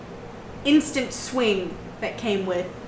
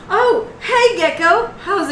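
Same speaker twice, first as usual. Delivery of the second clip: cheery greeting; 9 semitones higher, 4 dB louder, slower than usual. Acted.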